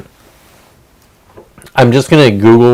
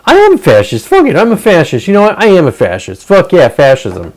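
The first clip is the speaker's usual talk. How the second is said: mock defiant teen; 6 semitones higher than usual. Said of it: "this kid was like".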